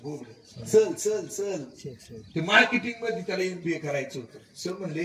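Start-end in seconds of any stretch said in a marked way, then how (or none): 0.6-1.6 चल for चर, a light lisp; lightly voiced lisping boy; lisping student. Preacher imitating the lisping student Rahul, lightly voiced. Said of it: "बोबडं बोलायचं".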